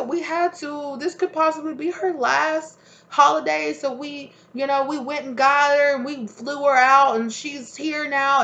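very high, loud, gushing announcement; the liar presenting grandma to everyone, high and gushing; fake excited announcement. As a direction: sell the big surprise, overjoyed. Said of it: "But it was like".

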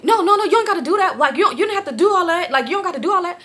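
high, quick, laughing protest; imitating his backpedalling. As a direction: mimic him talking fast and high.

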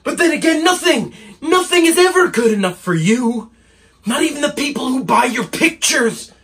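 high, bitter accusation; angry. Acted. Assistant accusing a never-satisfied painter, angry. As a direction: throw it at him in exasperation. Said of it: "he's quite angry".

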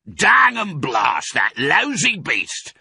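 slow, furious high shout; angry cry. Acted. Farmer Boggis cursing the fox, furious shout. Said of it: "cried Boggis".

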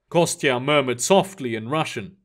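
ordinary delivery

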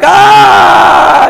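loud, high breathy crowd gasp; startled crowd gasp. Acted. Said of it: "everybody's like".

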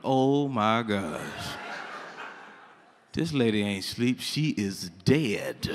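low, slow, hushed dawning horror; stunned realization. Retold or imitated. Boy realizes the sleeping lady is dead, stunned. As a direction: let it sink in, slow and low.

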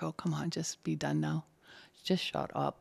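soft mock whisper to herself; whispered aside. Impatient in a dull meeting, whispered to herself. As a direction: breathe it out like an inner groan. Retold inner thought in meeting.

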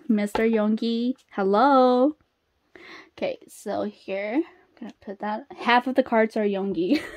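normal talking voice

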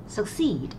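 "succeed" with the stress on its second syllable. Shown as a demonstration. Teacher models a word for an exam question, stress on second syllable of "succeed". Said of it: "trọng âm của từ này rơi vào âm thứ hai".

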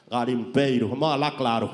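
a hint of a Brazilian-style gangster; mock gangster talking straight; foreign gangster accent. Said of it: "hablando de ese acento".

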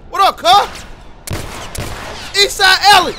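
loud high battle cry; taunting shout. Real, not acted. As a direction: yell the gang call at the enemy. Calling out enemy in gunfight.

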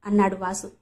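ordinary delivery, nothing marked

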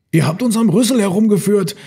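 high, exclaiming voice; tricked elephant. A narrator's reading. Herd member realises the trick, high exclamation.

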